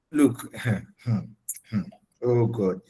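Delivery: breathless laughing whisper, slow. Real, not acted. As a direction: laugh under your breath in disbelief. Embarrassed at partying photos.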